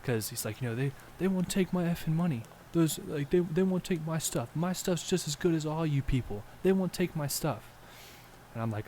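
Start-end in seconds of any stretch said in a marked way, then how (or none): 0.7-7.6 higher, indignant ranting voice of a ragged man; mimicking angry homeless man. Storyteller voicing the angry man who approached them, high and indignant. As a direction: act out the man's outrage, pitched up.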